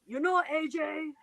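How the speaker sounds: slow, lightly voiced Gunther; a hint of Gunther, slow; Gunther impression. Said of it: "Gunther's like".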